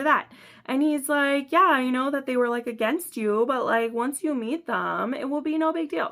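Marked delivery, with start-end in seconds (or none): 1.5-6.1 higher, breezy mock-casual boyfriend quote; casual paraphrase. Boyfriend reassuring her about meeting his family, exaggeratedly casual. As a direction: toss it off lightly in a raised voice. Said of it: "he doesn't say it that casually".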